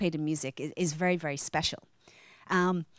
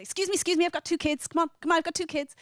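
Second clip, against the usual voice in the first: imitating mom elbowing into the pub; pushy mom in a crowd; 9 semitones higher, faster than usual. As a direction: Mom pleading to get her kids inside. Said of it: "She'd kind of push her way in and say".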